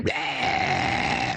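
low, breathy mock war cry; raiders' roar, hammed up; mock scream. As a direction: growl it like a charging mob. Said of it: "people are boiling off screaming".

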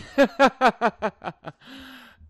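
loud burst of real laughter; big laugh. Real, not acted. Cohost's train joke sets off a loud laugh.

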